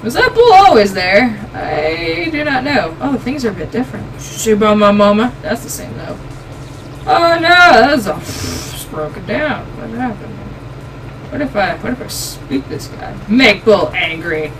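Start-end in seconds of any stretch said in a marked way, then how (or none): none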